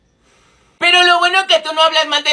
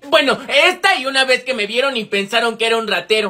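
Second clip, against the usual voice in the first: ordinary talk, nothing marked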